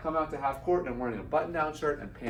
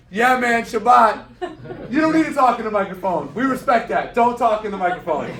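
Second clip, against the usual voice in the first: much higher and louder, a laughing yell from the stands; fan shouting; 9 semitones higher, 13 dB louder than usual. Retold or imitated. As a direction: cheer him on across the arena, half laughing.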